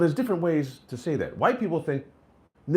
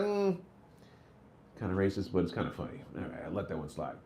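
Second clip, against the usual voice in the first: quiet amused; 8 semitones lower, 11 dB quieter than usual. Retold or imitated. Amused reaction.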